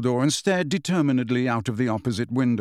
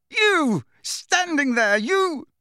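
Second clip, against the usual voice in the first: furious; 13 semitones higher, much slower than usual. Acted. Boy shouting incoherently at his headmaster. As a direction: spit each word out in rage. Harry raging at Dumbledore.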